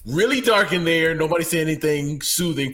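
usual speaking voice

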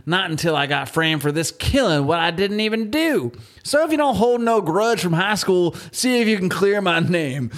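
hammed-up Deep South voice; Southern accent. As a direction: ham up a Southern convict's letter. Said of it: "talk like he's from, like, the Deep South".

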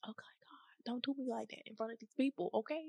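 whispered tearful prayer. Real, not acted. Speaker whispers to God, overcome, in a hushed voice. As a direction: breathe it under your breath to God.

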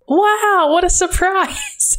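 high, slow, mock-amazed wow; sarcastic wow. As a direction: drawl it out like you're not surprised at all. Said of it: "that actually was a surprise to me".

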